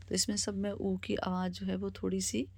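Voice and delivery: ordinary delivery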